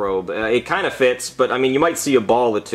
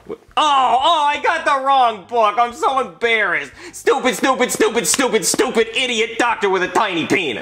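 higher, whiny self-berating; embarrassed self-scolding; 10 semitones higher than usual. Acted. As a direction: wail at your mistake. Wrong book, embarrassed.

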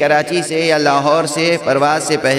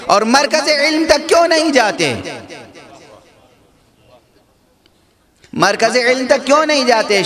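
preaching at full force; 6 semitones higher than usual. Acted. Preacher urging listeners to seek knowledge, full-force preaching. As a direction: rouse the congregation with each question.

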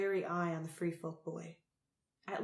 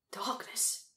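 a breathless whispered oath, pitched up; whispered curse; 4 semitones higher than usual. A narrator's reading. Crow sees the Keeper and swears in a whisper. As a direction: swear under the breath in alarm. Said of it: "he swore".

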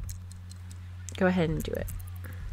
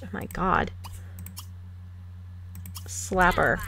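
usual speaking voice

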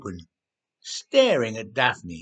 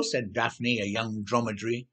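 plain reading voice

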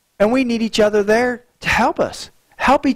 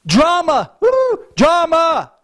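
mock exclaimed drama; 7 semitones higher, slower than usual. Real, not acted. Preacher mocking church gossip, excited and playful. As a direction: toss the word out with a whoop. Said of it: "preacher exclaims about drama".

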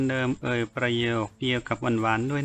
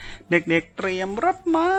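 much higher, slow call to the servants; summoning servants through the doorway, a high drawn-out shouted command; shouted order; 6 semitones higher, 3 dB louder, slower than usual. A narrator's reading. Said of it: "เปิดประตูออกไปตะโกนสั่ง".

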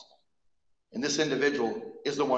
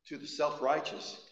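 ordinary talk

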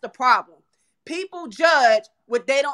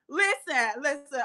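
ordinary delivery

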